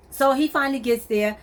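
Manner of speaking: ordinary delivery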